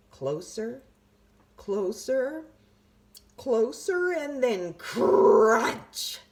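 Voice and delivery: building suspense. A narrator's reading. Narrator building to the bite, rising. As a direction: creep up then build the tension.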